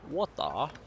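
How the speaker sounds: a quick, half-joking fake British accent on "Water?"; a hammed-up British "Water?" with no R at the end. Shown as a demonstration. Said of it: "you will just get my fake British accent".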